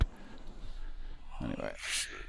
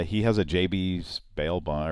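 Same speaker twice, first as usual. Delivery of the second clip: ordinary talk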